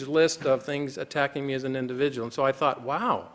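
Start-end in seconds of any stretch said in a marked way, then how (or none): none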